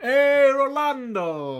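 "Rolando" slow and high with Spanish flair; Spanish accent. Pretend. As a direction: greet "Rolando" like a telenovela host. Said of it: "I want to say Rolando".

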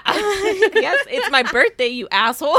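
high, loud indignant comeback through laughter; hammed-up outburst, laughing along; laughing mock indignation. Fan catching up with Peter Pan, laughing mock indignation.

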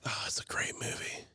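a sudden hushed whisper. Real, not acted. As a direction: drop to a breathy whisper. Drops to a whisper mid-chat.

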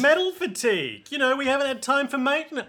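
high-pitched little mechanic voice; tiny helper excuse. Acted. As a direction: make excuses in a small, high voice. Continues as little Sex Pistols.

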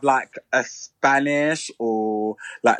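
normal talking voice

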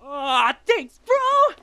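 high, loud delighted thanks; excited. Acted. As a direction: gush with delight. Given reporter role.

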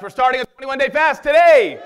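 loud, high rallying shout; real excited shout to the church. Real, not acted. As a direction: fire the crowd up about the fast. Rallying congregation about fast.

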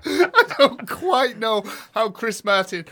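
loud laughing. Real, not acted. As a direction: laugh through the words. Laughing while speaking.

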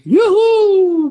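high, loud cheerful squeal "Joehoe"; little joyful squeal. Pretend. Therapist voicing the reborn little girl's squeal of joy. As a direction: squeal like a freed happy little girl. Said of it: "geeft misschien nog even een klein gilletje".

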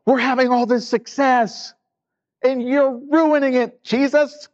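high-pitched mock whine; whiny imitation of aggrieved followers; grumbling disciples. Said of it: "The disciples are grumbling because".

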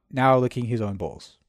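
ordinary talk, nothing marked

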